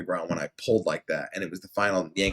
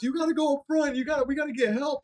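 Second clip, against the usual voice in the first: high, hurried panicky voice; urgent manager; faster than usual. Retold or imitated. Manager urging the injured worker to get help, panicky. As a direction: rush him, pleading.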